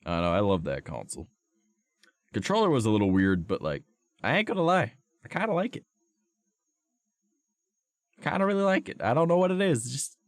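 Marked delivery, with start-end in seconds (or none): 4.2-10.2 high, giddy and fond; gushing over a favorite thing; excited. Real, not acted. Gushing about the controller.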